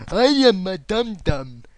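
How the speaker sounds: loud, high, drawn-out dummy voice; slow hammed-up idiot impression; mock dimwit. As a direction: play the commenter as a loud dope. Mock dumb voice for commenter.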